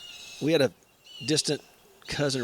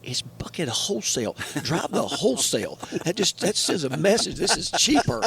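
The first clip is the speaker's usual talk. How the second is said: correcting partner; 4 semitones higher than usual. Retold or imitated. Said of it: "Charlie go".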